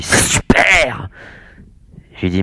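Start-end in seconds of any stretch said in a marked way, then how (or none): 0.0-1.1 high, drawn-out excited roar; gleeful roar. A narrator's reading. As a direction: roar it through a broad grin, stretching the word out. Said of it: "il arbora un large sourire et rugit". (rugissement enjoué)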